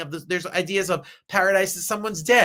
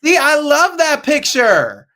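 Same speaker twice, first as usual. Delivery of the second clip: high, loud, drawn-out delight; gushing; 9 semitones higher, 11 dB louder, slower than usual. Real, not acted. Reacting to a beloved picture, gushing. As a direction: gush loudly, stretching the words.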